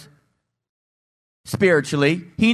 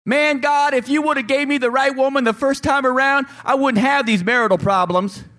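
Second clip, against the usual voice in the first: higher, whiny griping; mock complaint to God; complaining husband; 7 semitones higher than usual. Husband griping to God about his wife, mock whiny. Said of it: "we like to blame our wives".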